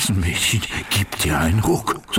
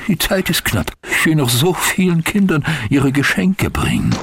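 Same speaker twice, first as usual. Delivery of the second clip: high, louder, in a rush; hurried and excited; 9 semitones higher, 4 dB louder than usual. Acted. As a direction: hurry off excitedly. Santa rushing off, hurried.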